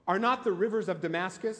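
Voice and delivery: ordinary talk, nothing marked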